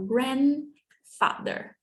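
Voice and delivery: Grandfather said slowly with the D left out, gran-father; dropped D. Shown as a demonstration. Teacher to students, modelling the word: Grandfather without the D. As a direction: drop the D in Grandfather, closing the N. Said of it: "we usually don't pronounce the D".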